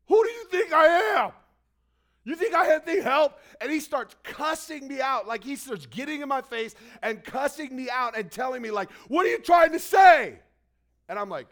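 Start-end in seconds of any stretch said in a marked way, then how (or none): 0.1-3.4 much higher, much louder furious yell; drunken angry shout. Retold or imitated. Drunk man turning on helpful stranger, furious shout. 9.1-10.4 much higher, much louder furious yell; drunken angry shout. Retold or imitated. Drunk man turning on helpful stranger, furious shout.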